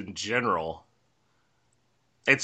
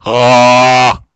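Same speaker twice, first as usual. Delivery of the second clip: loud short zombie groan; 2 semitones higher, 16 dB louder than usual. Pretend. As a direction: belt out a loud zombie moan. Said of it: "good zombie noise".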